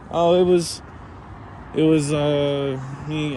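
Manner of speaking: mock-nervous answer to the boss; nervous and confused. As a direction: own up hesitantly, dragging out the filler. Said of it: "like, confused and, like, nervous".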